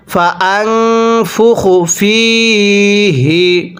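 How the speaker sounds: slow chanted tajweed recitation; slow melodic Quran chant. Shown as a demonstration. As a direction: recite it long and measured.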